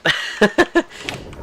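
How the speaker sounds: high breathy laugh of delight; burst of laughter. Real, not acted. Gamer laughing as the skeleton kills the creeper. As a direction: laugh out, thrilled at the luck.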